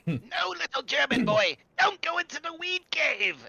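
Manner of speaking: shrill high cartoon voice. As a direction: squeal it out in alarm.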